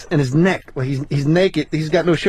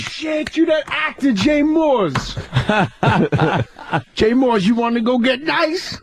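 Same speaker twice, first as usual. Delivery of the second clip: high, excited hollering voice; Tracy impression; 6 semitones higher, slower than usual. As Tracy inviting the comic to smoke, high and excited. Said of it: "He goes".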